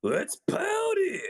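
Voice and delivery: very high, drawn-out shout; exaggerated party-cry impression; character battle cry. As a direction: belt out a game catchphrase, stretched long. Mimicking a character's catchphrase.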